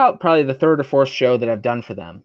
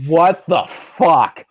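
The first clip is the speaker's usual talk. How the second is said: slow, drawn-out disbelief; incredulous; 2 semitones higher, slower than usual. Retold or imitated. Wrestler recounting his reaction to the movie pitch, incredulous. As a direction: stretch each word out in retold astonishment.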